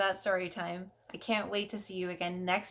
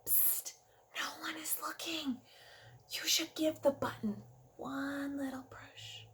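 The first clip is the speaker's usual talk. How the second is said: whisper; 3 semitones higher, 10 dB quieter than usual. Acted. Teacher tempting the kids to push, whispering. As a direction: lean in and tempt them in a hush.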